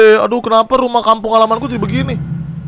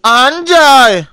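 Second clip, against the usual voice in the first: startled shout; 5 semitones higher, 6 dB louder than usual. Real, not acted. Jump scare in horror game.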